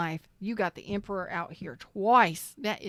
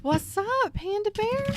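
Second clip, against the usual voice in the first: much higher, sing-song hello; playful greeting; 13 semitones higher, 3 dB louder than usual. Real, not acted. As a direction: coo it up high and playful.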